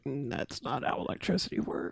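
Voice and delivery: hushed, breathy sarcastic aside; whispered aside. Real, not acted. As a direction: say it under your breath, deadpan. Sarcastic aside on movie physics.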